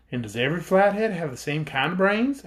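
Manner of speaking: put-on a girl's high, eager question; young Dorothy asking. A narrator's reading. Dorothy asks the Flathead chief, girlish and eager. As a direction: ask like a curious young girl. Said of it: "asked Dorothy".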